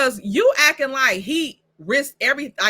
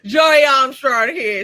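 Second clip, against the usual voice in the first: laughing; 7 dB louder, slower than usual. Real, not acted. Mocking a character's introduction.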